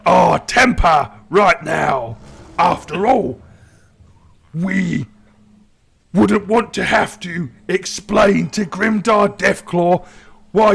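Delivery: lightly voiced orc, measured and placating; orc adviser. Agzok warning the warlord not to lose temper, calm orc voice.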